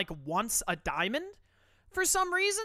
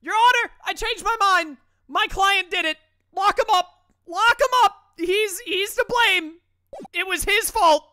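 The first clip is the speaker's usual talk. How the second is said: outraged high yell; 12 semitones higher, 12 dB louder than usual. Real, not acted. Mock lawyer outrage.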